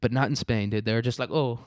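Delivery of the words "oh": put-on high-pitched, botched Spanish accent; failed Spanish accent. Comic riffing on Spain's blackout, failed Spanish accent. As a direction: badly fake a puzzled Spaniard. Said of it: "I can't do a Spanish accent".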